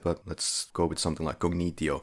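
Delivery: usual speaking voice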